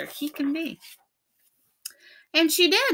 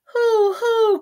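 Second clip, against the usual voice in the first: high, crying owl hoo; a mournful high hoo hoo; sad owl hoot; 6 dB louder than usual. A narrator's reading. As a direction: hoot it sadly, close to tears. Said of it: "cried Owl sadly".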